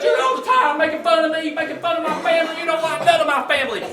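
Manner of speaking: wife complaining to husband about her family, mock upset. Mock upset wife.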